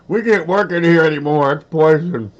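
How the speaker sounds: high, loud mock coworker complaint; disgusted coworkers. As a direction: squeal it like a grossed-out coworker. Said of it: "show up at work and everybody".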